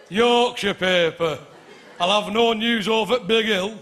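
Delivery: walk it to a brass band beat; mock slow Yorkshireman; slow Yorkshire plod. Brass band pace of Yorkshire life.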